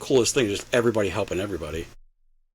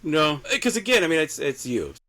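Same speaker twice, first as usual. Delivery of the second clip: high, loud no, no; emphatic protest; 8 semitones higher, 4 dB louder than usual. Real, not acted. Teased about her name.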